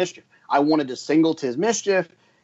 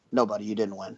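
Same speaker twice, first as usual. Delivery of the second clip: low, soft deadpan delivery; Ryan Flanders, deadpan; 5 semitones lower, 5 dB quieter, faster than usual. Retold or imitated. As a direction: break the news deadpan, dropped low and quiet. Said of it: "that's very much his deadpan delivery".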